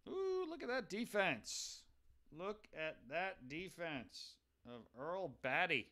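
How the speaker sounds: slow, high, drawn-out ooh of admiration; impressed. Real, not acted. Streamer admiring a catcher's defense, drawn-out impressed ooh. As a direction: savor it like a great find.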